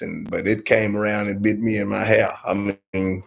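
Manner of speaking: usual speaking voice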